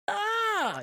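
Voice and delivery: very high shrieked ah, imitating terror; mock terrified scream. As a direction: squeal it like she's scared stiff. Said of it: "she's fucking terrified".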